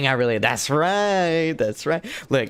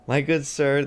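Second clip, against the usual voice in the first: usual speaking voice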